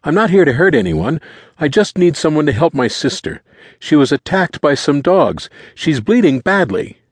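put-on high, urgent boy's voice; high-pitched, desperate explanation; frantic teenage boy, pleading. A narrator's reading.

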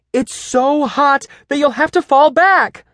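high, loud pretend alarm; exclaiming. A narrator's reading. Teacher urging students to fall from pretend fire, high and dramatic. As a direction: play up the danger like a keen drama coach. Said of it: "ended in an exclamation mark".

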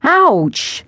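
high-pitched yelp of pain; pained grunt. A narrator's reading. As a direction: cry out as if punched in the chest. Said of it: "He grunted".

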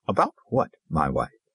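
slow, understated question to a wife. A narrator's reading. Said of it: "he asked slowly".

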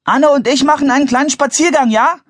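high, loud announcement across a distance; calling out. A narrator's reading. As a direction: call it to your parents, pitched high. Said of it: "rief er". (laut und hoch zurufend)

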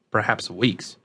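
ordinary delivery, nothing marked

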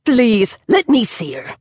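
a clipped, eager demand from a crone; impatient snap. Acted. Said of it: "the woman snapped".

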